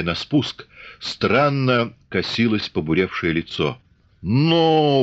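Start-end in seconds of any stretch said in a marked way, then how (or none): 4.2-5.0 sharp, higher, louder menacing cry; threatening shout. A narrator's reading. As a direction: bark a warning to stop him. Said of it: "угрожающе вскрикнул урядник". (угрожающий выкрик)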